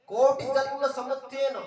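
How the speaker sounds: loud, high, slow scripture call; forceful verse recitation. Real, not acted. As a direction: proclaim the verse to the assembly at full voice. Preacher calls out verse forcefully. (ଉଚ୍ଚ ସ୍ୱରରେ ଶ୍ଲୋକ ଘୋଷଣା)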